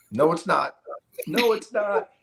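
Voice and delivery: high-pitched choked-up protest, retold. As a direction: reenact being overcome, voice climbing on the repeat. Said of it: "I'm getting all choked up".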